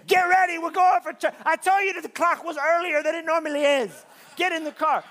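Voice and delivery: put-on high, loud frustrated yelling at the kids; mock parent yelling. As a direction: bark it at the kids to hurry.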